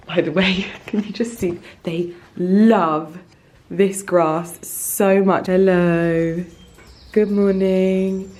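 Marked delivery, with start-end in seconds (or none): 5.5-8.3 slow, louder sing-song hello to the dogs; greeting the dogs. Real, not acted. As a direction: coo good morning at the pets.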